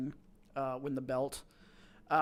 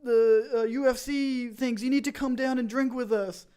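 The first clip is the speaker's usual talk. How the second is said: higher and louder, pushy mate on the phone at the pub; excited drunk brother; 6 semitones higher, 7 dB louder than usual. Retold or imitated. Brother calling from the pub, loud and excited. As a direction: egg him on like a keen brother at the pub. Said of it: "he's like".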